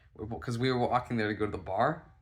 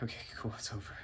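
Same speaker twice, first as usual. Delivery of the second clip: understated relief; 7 dB quieter, faster than usual. Retold or imitated. Walking away from her, quietly relieved. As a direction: say it softly to yourself.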